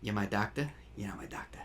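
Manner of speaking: put-on low, soft New York accent, R dropped off "doctor"; quiet, menacing Brooklyn retort, falling to near whisper. Cabbie retorts to man claiming to be his doctor, Brooklyn accent. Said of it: "It's a specifically, like, Brooklyn, New York kinda accent".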